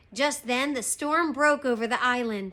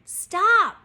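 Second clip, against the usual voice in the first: loud, high-pitched shout; sharp storybook captain's bellow; yell; 10 semitones higher, 10 dB louder than usual. Acted. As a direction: yell it after fleeing men. Captain yells after fleeing men.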